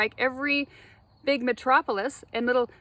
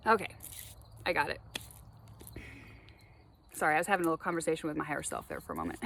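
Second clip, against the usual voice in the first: ordinary talk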